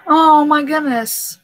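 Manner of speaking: slow overwhelmed sigh of words; drawn-out exclaim. Real, not acted. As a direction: let the oh linger in disbelief.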